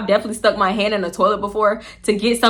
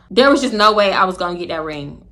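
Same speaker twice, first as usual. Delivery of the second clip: usual speaking voice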